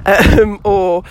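ordinary talk, nothing marked

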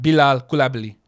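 Bilal Koulibaly said in a French accent, stress on the last syllable; mock French name reading. Shown as a demonstration. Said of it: "Like that".

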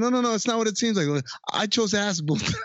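high, fast, panicky denial; mock panic, quick and high; frantic defense. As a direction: rush to explain it away.

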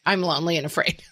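ordinary delivery

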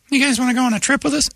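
high falsetto mimicry; friendly softball invitation. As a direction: invite them in a put-on squeaky voice. Imitates invitation from softball folks.

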